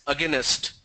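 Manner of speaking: against said as "aginest"; three-syllable against, "a-gi-nest"; regional mispronunciation. Shown as a demonstration. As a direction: add a vowel so against ends in "nest". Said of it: "they pronounce that as".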